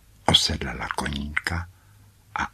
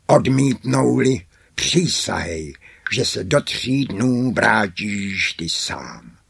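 raised, forceful beast's demand, loudest at the start; commanding monster; 4 semitones higher, 4 dB louder than usual. A narrator's reading. Monster makes the merchant swear to return, forceful command. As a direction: open loud and high, then command slowly.

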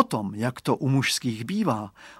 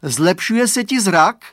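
high, loud, slow question; boy asking; 7 semitones higher, 9 dB louder, slower than usual. A narrator's reading. Boy asks friend about eyesight, high and loud. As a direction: ask it eagerly and slowly. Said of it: "otázal se".

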